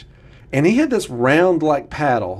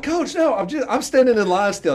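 high, pleading younger self; pleading kid; 9 semitones higher than usual. Retold or imitated. Student begging the coach, high and pleading.

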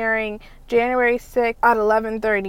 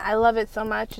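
usual speaking voice

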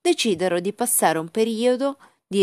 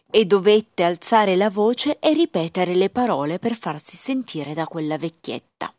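usual speaking voice

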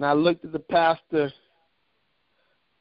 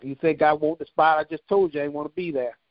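ordinary talk, nothing marked

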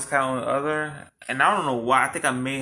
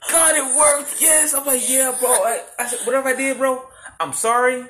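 relieved high voice; 10 semitones higher than usual. Retold or imitated. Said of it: "I was like".

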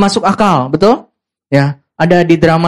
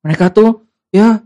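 ordinary delivery

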